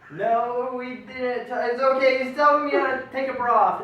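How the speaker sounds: high, loud panicked protest; frantic denial. Retold or imitated. Son to mom over breakfast, high loud frantic denial. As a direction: insist in a rush it was innocent.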